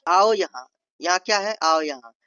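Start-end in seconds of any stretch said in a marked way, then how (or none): 0.1-0.7 loud order, falling at the end; firm, loud command. Shown as a demonstration. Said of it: "आदेश के लहजे में". 1.6-2.1 loud, short order; firm order with a falling end; commanding. Shown as a demonstration. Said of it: "आदेश के लहजे में".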